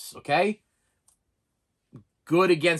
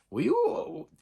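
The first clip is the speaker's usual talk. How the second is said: mock question; 10 semitones higher than usual. Mock asking friend if he'd see it.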